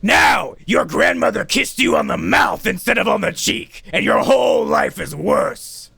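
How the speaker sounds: high, loud melodramatic narration; hammed-up shouting. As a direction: proclaim the listener's doom at full voice. Mock narrator shouts doom at listener.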